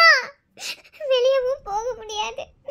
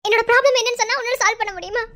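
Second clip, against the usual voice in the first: usual speaking voice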